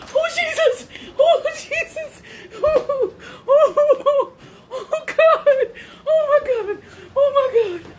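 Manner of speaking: high, half-laughing, half-crying wail; shaken wailing. Real, not acted. Woman calling on Jesus after the bird scare, shaken wailing. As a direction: wail to God in shaky relief.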